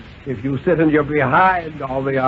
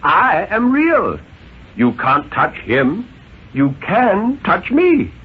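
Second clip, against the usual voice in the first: a hint of a stern, deliberate father; father's voice; 4 semitones higher, 4 dB louder than usual. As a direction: spell out each word to the child. Father asserts reality.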